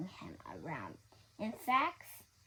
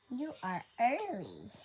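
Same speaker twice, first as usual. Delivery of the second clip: slightly raised, prim tone; a hint of stern librarian; 5 semitones higher, 6 dB louder than usual. A narrator's reading. Librarian primly telling the lion he is early, light stern voice. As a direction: point out the lateness primly. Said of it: "said Miss Merriweather".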